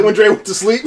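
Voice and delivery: high, loud, amused delivery; laughing. Real, not acted. Podcaster joking the track was snuck on, laughing. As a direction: get the joke out through laughter.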